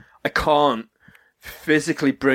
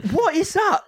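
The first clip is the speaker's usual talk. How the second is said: baffled disbelief; 11 semitones higher than usual. Retold or imitated. Acting out baffled reaction to the voice.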